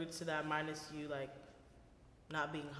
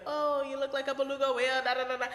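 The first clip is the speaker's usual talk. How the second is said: higher, louder teasing impression of relatives; mock taunt; 7 semitones higher, 8 dB louder than usual. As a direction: ham up the careless joker. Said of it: "they'll be like".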